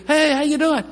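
high, bright-up hello; cheery greeting. Retold or imitated. Pastor greeting a neighbor walking by, high and cheery. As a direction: greet a passerby sweetly.

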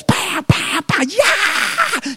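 high, excited mock screaming pow pow; imitated wild yell. Friend recording his screams in a storage shed: high mock yells. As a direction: bang and holler like a kid in a metal shed.

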